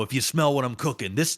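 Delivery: ordinary talk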